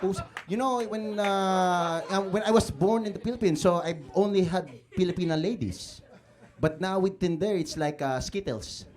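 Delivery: put-on Filipino uncle with a thick accent; Tito Chito. Uncle telling the crowd about his dates, boastful Filipino-accented character.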